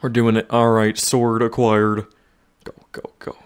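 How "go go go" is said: whispering. Real, not acted. Whispered urging.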